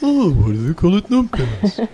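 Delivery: high dim-witted voice, mock confused; mock slow listener. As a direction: play the slow listener, puzzled. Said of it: "anyone who's really slow is still thinking".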